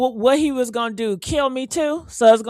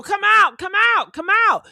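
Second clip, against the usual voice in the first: higher, raised calls of come out; crowd calling out; 7 semitones higher, 4 dB louder than usual. Pretend. Imagined crowd calling people out of rooms, raised voice. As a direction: call everyone out into the hall. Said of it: "I hear everybody out there".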